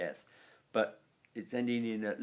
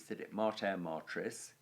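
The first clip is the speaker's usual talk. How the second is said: ordinary delivery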